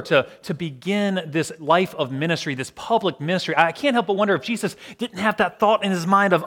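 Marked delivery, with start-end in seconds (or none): none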